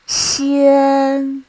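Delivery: Xian held long, high and level; first tone. Shown as a demonstration. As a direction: say Xian on one steady high note. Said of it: "this is how we mark the tones".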